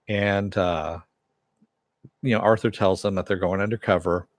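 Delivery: ordinary talk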